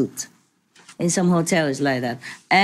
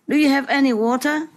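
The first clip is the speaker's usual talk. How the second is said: higher, fast pleading voice; desperate thirsty man; 8 semitones higher, faster than usual. Acted. Thirsty man begging the tie seller, higher and fast. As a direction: beg for water, rushing.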